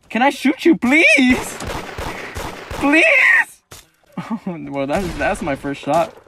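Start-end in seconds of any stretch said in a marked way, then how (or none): 0.1-3.0 pleading high and loud. Real, not acted. Gamer begging an enemy to let him shoot. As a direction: beg the target in a squeaky voice.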